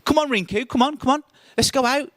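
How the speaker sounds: high, eager coaxing from the popular lads; eager urging. Retold or imitated. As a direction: egg him on, all keen and excited.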